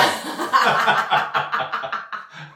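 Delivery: high breathless laugh; high laughter. Real, not acted. After mocking the tailor, high laugh. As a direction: laugh at her own impression.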